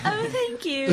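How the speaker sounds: very high, put-on British accent. Performer thanking mistaken fans, mock British accent. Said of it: "Did you do it in a British accent?".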